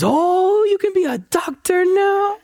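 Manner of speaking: high, hopeful slow question; a grandmother suddenly thrilled at the idea; imitating grandmother's sudden high eager hope; delighted grandma.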